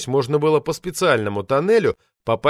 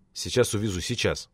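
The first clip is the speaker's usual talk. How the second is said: softer, calming reassurance; soothing; 5 semitones lower, 7 dB quieter than usual. A narrator's reading. Narrator calms the administrator, promising to take him away. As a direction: settle everyone down gently. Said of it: "успокоил я".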